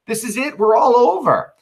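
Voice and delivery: high, loud settling the crowd; loud insistent Brutus. Acted. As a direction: call out that the fight is over. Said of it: "Brutus says".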